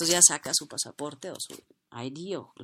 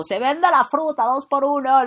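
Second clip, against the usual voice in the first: higher, louder vendor shout; market call; 8 semitones higher, 9 dB louder than usual. Acted. Fruit seller shouting her offer to passersby. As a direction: hawk fruit to the crowd.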